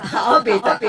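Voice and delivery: ordinary talk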